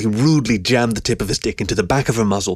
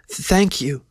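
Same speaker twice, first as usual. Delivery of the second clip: higher voice, hesitant start; shy stammer; 8 semitones higher than usual. A narrator's reading. Keo thanking him once standing, in a shy high stammer. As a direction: stammer it out shyly, pitched up. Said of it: "she stammered shyly".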